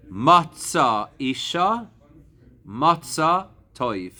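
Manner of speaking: Matzah Isha, Matzah Toiv spoken slowly and deliberately; teacher intoning a proverb; slow quoting of a proverb. Shown as a demonstration. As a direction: savour the proverb word by word. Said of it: "an expression that you should all know".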